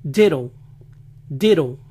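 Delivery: "Ditto" as "DI-row"; American "Ditto" with flapped T; T as tapped R. Shown as a demonstration. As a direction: say "Ditto" with a Spanish single R for TT. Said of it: "como nuestra R".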